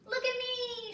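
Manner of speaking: put-on high, excited call of a little girl; child shouting. A narrator's reading. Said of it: "she shouted".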